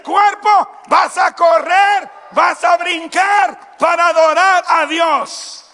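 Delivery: preacher shouting orders to his body to worship; commanding shout. Pretend. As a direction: roar it slowly at your own body. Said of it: "y le dices".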